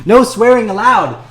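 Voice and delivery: high, loud, slow prissy scold; mock scolding. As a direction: play the stern censor.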